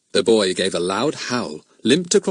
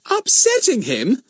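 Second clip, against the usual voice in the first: slow, much higher indignant rise on upsetting; slower than usual. A narrator's reading. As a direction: throw "Upsetting him?" back at her. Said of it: "stared at her indignantly".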